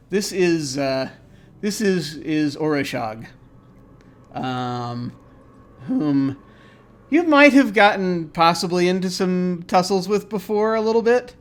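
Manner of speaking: ordinary delivery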